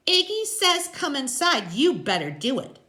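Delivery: high, loud character woman; pushy biker's woman; Loretta, bossy. A narrator's reading. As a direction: warn them in a high, pushy voice. Voices Loretta in story.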